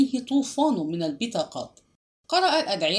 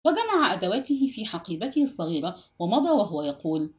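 plain reading voice

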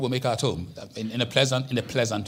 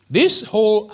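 too loud; 8 semitones higher, 8 dB louder, slower than usual. Real, not acted. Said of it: "let me calm myself".

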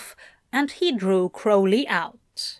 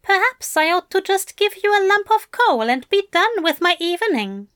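higher, quick mock-stern teasing; teasing Aziraphale; 10 semitones higher, 3 dB louder, faster than usual. A narrator's reading. Aziraphale teasing Crowley with mock sternness, quick. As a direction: rattle off a playful threat.

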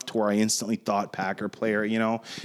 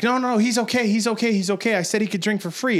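high imitation of an anxious peacemaker; pleading reassurance, pitched up; urgent placating; 8 semitones higher than usual. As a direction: act out a friend hastily smoothing things over. Friend intervenes to calm bouncer.

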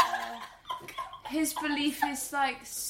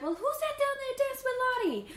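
much higher, curious voice; mock Tiana question; 12 semitones higher, faster than usual. Tiana spotting the frog dancing, high curious voice. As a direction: pitch it way up, wondering. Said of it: "she's like".

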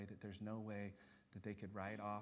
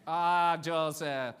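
put-on loud, raised exclamation in a Filipino accent; mock Filipino accent; 6 semitones higher, 14 dB louder, much slower than usual. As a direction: exclaim as a hammed-up Filipino relative.

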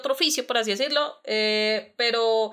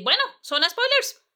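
excited announcement; 11 semitones higher, 3 dB louder than usual. Acted.

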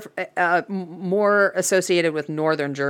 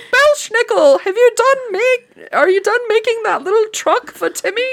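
high squeaky voice, fast; squeaky cartoon boss calling his elf; christmas elf boss; 4 dB louder than usual. Voicing boss asking elf.

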